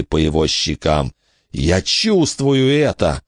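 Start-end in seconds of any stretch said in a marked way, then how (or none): 1.5-3.2 slow, high, pained moan. A narrator's reading. Billy moans feeling the ghost's pain. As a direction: ache through the words. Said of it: "простонал он".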